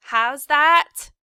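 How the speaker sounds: loud high shout of not hearing. A narrator's reading. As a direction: yell back, half deaf. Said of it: "shouted Mig".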